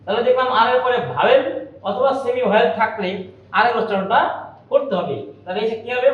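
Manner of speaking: ordinary delivery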